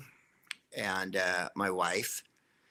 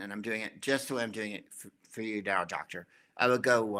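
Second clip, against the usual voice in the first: ordinary delivery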